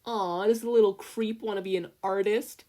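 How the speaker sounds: high, loud syrupy taunt; sickly sweet. A narrator's reading. Bully mocking Izuku's art in a sickly sweet taunt. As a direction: coo it with fake sweetness. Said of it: "in a sickly sweet tone".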